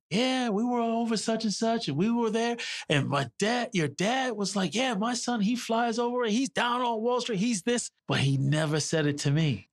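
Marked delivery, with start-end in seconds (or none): 0.1-7.9 lightly voiced mom, pitched higher; a hint of proud mom passing on gossip; mom retelling dad's praise. Son recalls his mom relaying dad's praise, lightly voiced higher. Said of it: "I always heard it through my mom".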